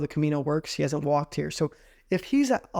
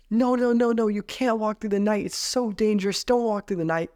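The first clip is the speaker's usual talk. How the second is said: put-on higher, urgent girls pleading no; worried warning; 5 semitones higher than usual. Andalusian girls warn them not to walk, higher urgent pleading. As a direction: warn them it's too dangerous.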